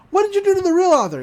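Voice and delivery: high, loud, rapid accusation; accusing demand. Retold or imitated. Boy confronting the shapeshifter about the real author, accusing. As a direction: demand it fast, pitched high.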